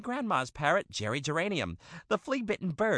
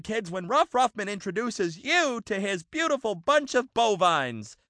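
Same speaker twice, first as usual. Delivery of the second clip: usual speaking voice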